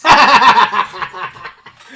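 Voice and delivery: loud burst of laughter; laughing. Real, not acted. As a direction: crack up out loud. Laughing at the answer.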